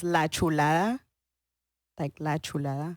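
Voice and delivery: La Chulada said in a Spanish accent. Shown as a demonstration. Host asks her to say the show name in Spanish accent. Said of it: "And put the accent in".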